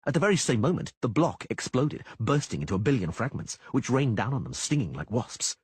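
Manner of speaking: normal talking voice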